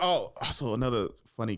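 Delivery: ordinary delivery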